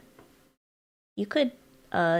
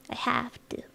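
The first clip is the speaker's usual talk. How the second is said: hushed whisper to herself; whispered to self; 4 semitones higher, 3 dB quieter than usual. Real, not acted. Streamer reminding herself to keep working, whispered.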